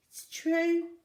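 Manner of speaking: high, slow admission; a little girl admitting something shameful; small girl confessing. A narrator's reading. Zoe voiced.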